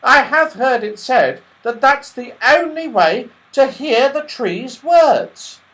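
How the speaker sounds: high, loud squirrel, slowing right down at the end. A narrator's reading. Squirrel sharing a secret with the children, high and slow. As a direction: confide it high and bright, then drag the last words slowly. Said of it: "said the squirrel".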